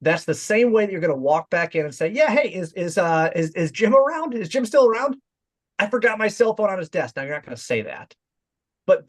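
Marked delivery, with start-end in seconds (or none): 2.1-7.1 higher, easy and familiar; like you were just here; casual confident. Shown as a demonstration. Rep walking back in for his forgotten phone, casual. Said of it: "you forgot something there".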